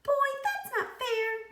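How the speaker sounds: high, slow whiny pigtail; whining pigtail. A narrator's reading. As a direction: whine it up high like a sulky pigtail. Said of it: "whined the pigtail".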